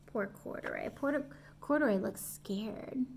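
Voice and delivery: soft pitying voice; poor Corduroy. Real, not acted. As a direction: say it softly with sympathy. Pity for bear.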